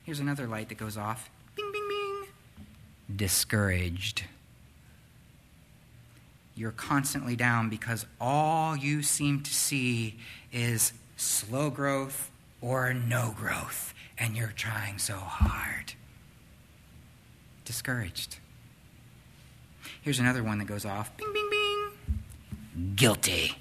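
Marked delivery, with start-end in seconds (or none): 1.5-3.2 high, soft, slow pinging chime; soft slow chime bings. Retold or imitated. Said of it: "comes with those annoying little sounds". 3.2-18.4 low, slow, sinking into a whisper; discouraged. Acted. Describing the discouraged warning light. 21.2-22.9 high, very slow pinging chime; ping each one slowly like a car chime; slow chime bings. Retold or imitated. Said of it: "comes with those annoying little sounds".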